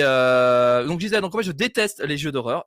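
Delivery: ordinary delivery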